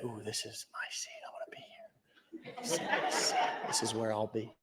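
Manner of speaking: hushed whisper claiming a seat. Retold or imitated. Audience member whispering over their favorite seat. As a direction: breathe it like a secret, half laughing.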